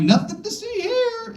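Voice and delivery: high, whiny innocent squeak; hiding the basket from Greta, high and innocent; mock innocent. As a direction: sing it out like nothing's wrong.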